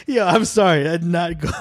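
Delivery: high, giggling sorry; laughing apology. Real, not acted. Laughing apology after loud audio.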